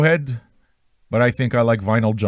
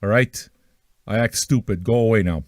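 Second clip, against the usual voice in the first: usual speaking voice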